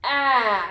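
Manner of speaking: "A" as in cat, held alone; short A sound. Shown as a demonstration. Teacher demonstrates the vowel sound, short "A" as in cat. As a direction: say "A" as an isolated short vowel, drawn out. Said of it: "It makes a short sound".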